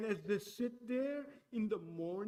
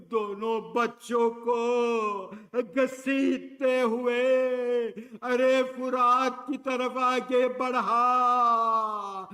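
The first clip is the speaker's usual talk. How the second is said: preaching at full force; 3 semitones higher, 12 dB louder, slower than usual. Acted. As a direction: drive the tragedy home from the pulpit. Urdu recital at full force.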